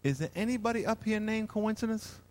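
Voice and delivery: softly puzzled God asking around; hushed, baffled heavenly inquiry; God in disbelief. Acted. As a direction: ask quietly, as a lightly voiced God. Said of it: "Here's God in heaven".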